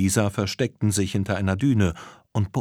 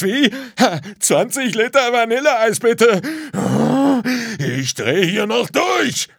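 exasperated man at breaking point; frantic customer; 10 semitones higher, 4 dB louder than usual. A narrator's reading. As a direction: rant building to a high, loud outburst. Desperate customer rants at pump.

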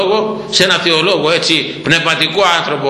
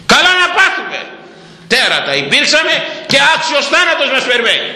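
thief's rebuke; 11 semitones higher than usual. Acted. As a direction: cry out the confession of guilt. Voicing the thief rebuking the other.

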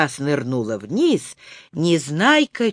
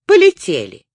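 ordinary delivery, nothing marked

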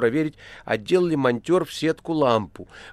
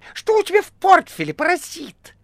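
very high, squeaky cat yell; piercing shriek; 9 dB louder than usual. A narrator's reading. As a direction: screech the accusation. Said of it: "пронзительно прокричал похожий на кота". (пронзительный писклявый крик)

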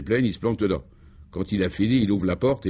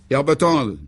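calling out; 7 semitones higher, 5 dB louder, slower than usual. Acted. The chief calls the commissaire in from outside, calling out loud. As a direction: call the name out, louder and higher. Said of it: "appela-t-il".

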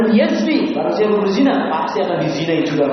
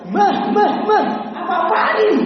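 much higher, louder outraged cry; companions shouting; 3 dB louder than usual. Retold or imitated. Companions shout at the young man asking to commit zina. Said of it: "Teriak semua".

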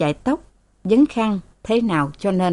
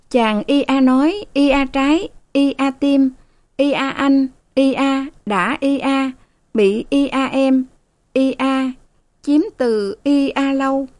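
halting reading; 7 semitones higher than usual. A narrator's reading. Maid reads novel aloud haltingly.

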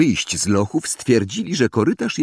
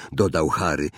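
ordinary delivery, nothing marked

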